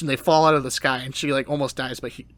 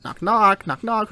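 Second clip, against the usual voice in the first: high, raised sing-out; imitating a visitor knocking aloud; calling at door; 7 semitones higher, 6 dB louder, slower than usual. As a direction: call out to whoever is inside. Heroine calling at castle door.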